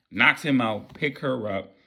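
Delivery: usual speaking voice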